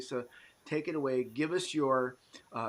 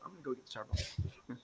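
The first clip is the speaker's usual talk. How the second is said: laughing quote; 8 dB quieter than usual. Retold or imitated. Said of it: "They go".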